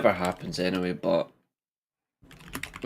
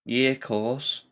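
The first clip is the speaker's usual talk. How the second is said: higher-pitched Scouse voice, trying it a second time; Scouse impression, pitched up; Scouse accent; 4 semitones higher, slower than usual. Shown as a demonstration. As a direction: repeat the line in put-on Scouse. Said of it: "manage a Scouse accent like that".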